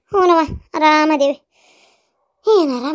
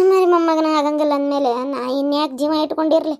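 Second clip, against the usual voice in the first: ordinary delivery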